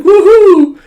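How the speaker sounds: very high, loud woohoo; thrilled child's whoop; cheering. Retold or imitated. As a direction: whoop with joy. Girl cheering.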